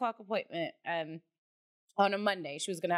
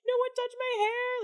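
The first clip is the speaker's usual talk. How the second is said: put-on high wailing toddler voice, much higher; bawling little girl. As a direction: wail it like a heartbroken three-year-old. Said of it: "started bawling".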